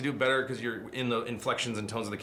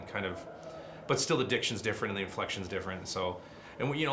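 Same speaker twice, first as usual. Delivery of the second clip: ordinary talk, nothing marked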